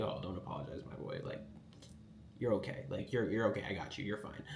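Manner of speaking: soothing the shy apprentice, softly reassuring. Retold or imitated. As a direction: talk him down tenderly like a big sibling. Comforting shy apprentice Uni.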